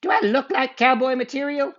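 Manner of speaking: put-on high, innocent little boy; kid voice. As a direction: play dumb sweetly. Avery speaking.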